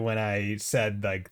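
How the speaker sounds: normal talking voice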